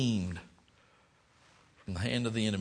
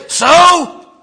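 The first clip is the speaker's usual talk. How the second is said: "So?" flung out high and loud; loud shouted "So?"; 19 dB louder than usual. Shown as a demonstration. Pastor leading the church in a defiant shout of "So?". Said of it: "leading the congregation's response".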